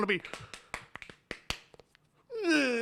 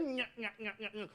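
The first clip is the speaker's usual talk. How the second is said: Stooge nyah taunt; 13 dB quieter than usual. Acted. Curly character taunt.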